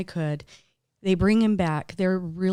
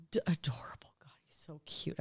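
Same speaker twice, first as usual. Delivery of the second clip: softer, whispered gushing over a puppy; cooing whisper; 3 semitones lower, 10 dB quieter, faster than usual. Real, not acted. Telling the hosts about her cute wiener dog, in a soft whisper. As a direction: breathe it out, melting over the dog.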